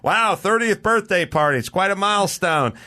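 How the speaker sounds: high, chirpy party guest line; party guest entrance. Retold or imitated. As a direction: play the cheerful guest arriving. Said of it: "you go".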